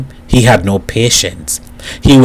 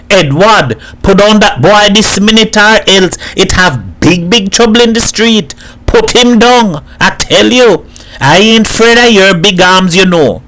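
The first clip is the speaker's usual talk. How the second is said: high, raised, forceful order; angry confrontation; 8 semitones higher, 4 dB louder than usual. A narrator's reading. Hat confronts Edward flogging Boy, angry raised voice. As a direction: step in and order him off.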